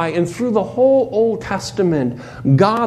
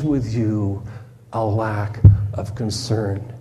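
quiet and tender, reassuring the listeners; tender reply; 6 semitones lower, 3 dB quieter than usual. Acted. God answers his doubting people.

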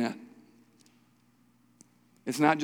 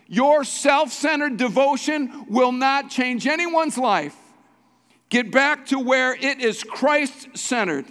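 raised, driving sermon delivery; impassioned preaching; 7 semitones higher, 4 dB louder than usual. Real, not acted. Pastor urging congregation to become Christ-centered, impassioned preaching. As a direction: preach it high and forceful.

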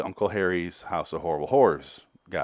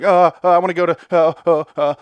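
put-on higher, louder stammering little kid; overwhelmed kid; 7 semitones higher, 8 dB louder than usual. Six-year-old kid freaking out at the amusement park, high stammer. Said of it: "having a fucking freak out because the kid is so overwhelmed".

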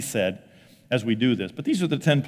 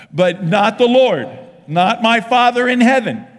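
loud, high proclamation; raised, ringing declaration; emphatic preaching; 10 semitones higher, 9 dB louder, slower than usual. Real, not acted. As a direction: belt it out with full conviction. Preaching at full force.

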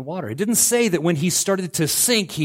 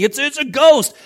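mock complaining; 11 semitones higher, 8 dB louder than usual. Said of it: "when they were complaining".

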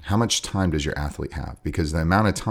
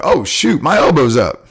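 mock startled fighter; 7 semitones higher, 7 dB louder than usual. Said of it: "you're like".

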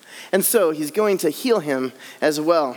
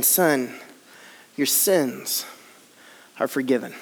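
lower, soft and tender; gentle Jesus; 7 semitones lower, 4 dB quieter, slower than usual. Acted. Jesus tenderly telling the paralyzed man, softly. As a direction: speak it gently like a blessing.

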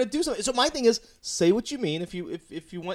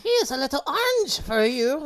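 creepy stranger; 10 semitones higher, 6 dB louder, slower than usual. Retold or imitated.

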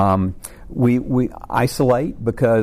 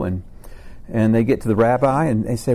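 normal talking voice

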